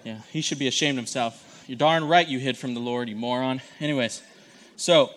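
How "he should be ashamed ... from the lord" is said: ordinary delivery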